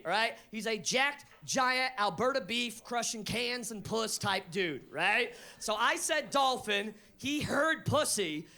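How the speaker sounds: ordinary talk, nothing marked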